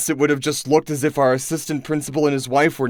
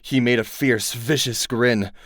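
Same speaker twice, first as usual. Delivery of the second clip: usual speaking voice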